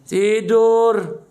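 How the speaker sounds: high, loud, stretched-out word; hammed-up send-off to slumber; mock lullaby. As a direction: croon it teasingly as an order to sleep. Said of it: "Nina Bobo".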